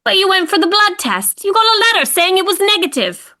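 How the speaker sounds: high, loud and incredulous; a wife who can't believe what she's hearing; shocked disbelief. Acted. Wife confronts husband about arrest.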